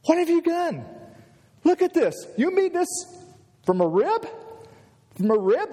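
high-pitched, delighted disbelief; acted-out astonished outburst; amazed excitement. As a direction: marvel out loud as if you can't believe it. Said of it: "context of excitement like you and I would feel".

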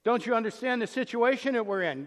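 loud mock protest; imitating defensive complainers, loud; exasperated objection. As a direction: push back like people who feel misunderstood. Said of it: "they're looking at the moment and going".

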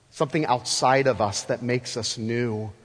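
usual speaking voice

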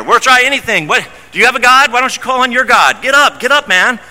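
high, pressing, hurried; desperate urging. Retold or imitated. Frightened sailors pushing Jonah to pray, high and urgent. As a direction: beg him to act now.

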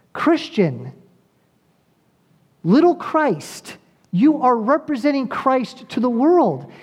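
high, ringing preacher's call, words stretched for weight; impassioned preaching. Acted. Preacher urging his congregation to represent Christ, fervent preaching. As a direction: preach it hard, lifting every phrase to the room. Preacher rising to full force.

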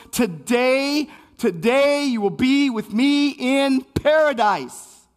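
loud, high, impassioned sermon voice; fiery pulpit preaching with long dramatic pauses; preaching at full force. Acted. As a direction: preach it out to the whole room, building in fervour. Preaching climax on the crucifixion.

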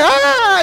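high startled yelp, backing down; scared yelp. Retold or imitated. Boy who was challenged yelps and backs off, high startled yelp. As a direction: yelp like a bully caught out. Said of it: "They go".

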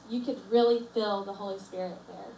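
usual speaking voice

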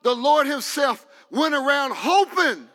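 loud, high, rhythmic shouted preaching; full-force preaching. Acted. Preacher building to the climax, shouting each phrase. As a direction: pound each phrase home to the congregation.

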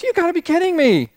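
ticked-off genie. Retold or imitated. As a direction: play the fed-up genie throwing up his hands. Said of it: "he gets all ticked off".

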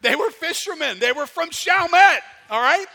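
excited punchline. Real, not acted. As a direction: hammer the joke home with glee. Excited punchline about Chalmette.

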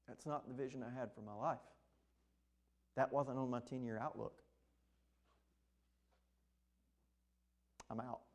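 lightly voiced quitter, trailing off; mock backing out. Follower backs out, voiced.

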